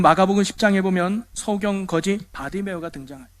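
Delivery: ordinary talk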